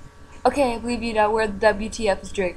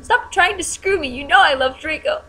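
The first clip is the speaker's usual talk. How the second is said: high-pitched mock shout; indignant, hammed-up shout; 7 semitones higher than usual. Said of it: "I shouted".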